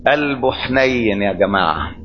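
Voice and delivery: preacher tenderly telling the congregation Jesus is kind. Acted. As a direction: let it melt with tenderness. Tender remark on Jesus' heart.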